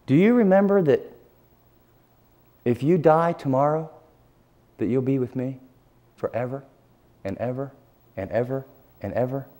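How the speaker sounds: soft, slowing promise fading to near silence; quiet, tender reassurance. Retold or imitated. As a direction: voice of God comforting gently. God's gentle voice reassuring him.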